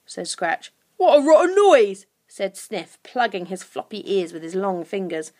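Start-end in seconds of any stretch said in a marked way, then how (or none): 1.0-2.0 loud, higher creature complaint; disgusted creature. A narrator's reading. Creature plugging his ears at the sobs, disgusted. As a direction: complain noisily like a goblin.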